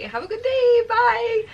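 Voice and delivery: very high, loud singsong goodbye, acted; forced cheery farewell. Clerk sees off the embarrassed couple, forced cheery. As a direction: squeak out a desperate send-off.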